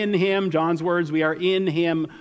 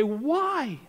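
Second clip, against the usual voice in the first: puzzled; 7 semitones higher than usual. Acted. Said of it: "you read that and you say".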